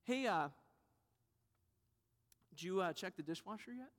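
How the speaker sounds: mock-casual, hesitant question; subtle hint. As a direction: fish for credit softly and offhand. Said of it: "We try these subtle ways".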